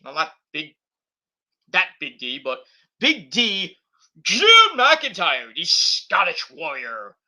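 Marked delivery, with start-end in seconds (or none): 3.0-7.2 slow, drawn-out announcer intro; announcer calling out the challenger; ring announcer. Pretend. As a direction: stretch the name out like a ring announcer.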